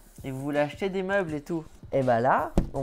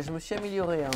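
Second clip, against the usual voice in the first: normal talking voice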